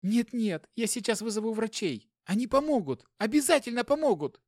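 high frantic insistence; cling to hope, pitch high; panicked. Acted. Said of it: "оторопела проговорила она". (в панике, высоко)

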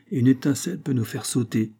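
ordinary delivery, nothing marked

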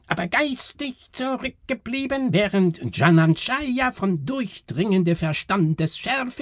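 high, piercing recitation in strange dialect; showwoman reading aloud. A narrator's reading. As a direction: proclaim it like a fairground freak-show announcement, very high, stretching key words. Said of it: "und las laut vor". (Schaustellerin liest laut vor, hoch und fremdartig)

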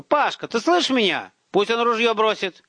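put-on high, urgent boyish holler; yelling. A narrator's reading. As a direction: shout across to your brother. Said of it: "послушно заорал Ромка".

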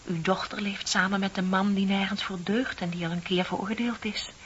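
ordinary talk

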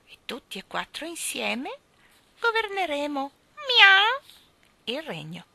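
cat gloating over its prize; triumphant cat. Acted. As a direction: crow over the kingdom like a cat. Cat claims the kingdom.

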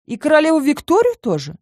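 high, rising disbelief; incredulous question. Acted. Incredulous question about clan legend.

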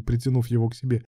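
usual speaking voice

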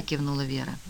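plain reading voice

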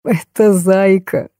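slow, drawn-out groan of amusement. A narrator's reading. Degtyarev reveals the monster's identity, groaning slowly. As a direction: drag the words out in a mild moan. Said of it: "простонал Дегтярев".

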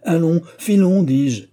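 high, loud order to leave; angry outburst. A narrator's reading. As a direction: drive them out, voice jumping up. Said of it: "ses éclats de voix". (éclat de voix)

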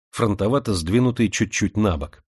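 usual speaking voice

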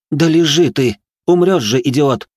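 high, loud exasperated yell; angry shout. A narrator's reading. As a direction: yell at him to stay down. Marika yells at struggling Vincent.

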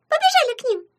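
very high, loud rallying cry; excited squeal. A narrator's reading. Imp urging friends to run over, excited squeal.

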